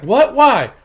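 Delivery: high, loud disbelief; acted-out startled outburst; mock shocked. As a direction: demand an answer in mock shock. Girlfriend shocked about cat.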